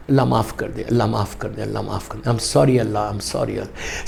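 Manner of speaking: low murmured plea, fading almost to nothing; hushed repentance. Shown as a demonstration. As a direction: show how to quietly apologise to God. Models a private plea for forgiveness. (بہت دھیمی آواز میں توبہ)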